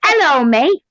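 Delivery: high-pitched put-on Australian accent. Shown as a demonstration. Showing off languages to the room, put-on Australian accent. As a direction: greet them in a high, hammed-up Australian accent. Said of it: "how was that for Australian".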